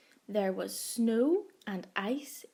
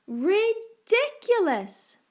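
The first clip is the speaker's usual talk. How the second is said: much higher, loud, slow outraged exclamation; outraged cry of ridiculous; indignant cry; 10 semitones higher, 9 dB louder, slower than usual. A narrator's reading. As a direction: bellow it like a scandalised father. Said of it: "cried Mister and Missus Tortoise together".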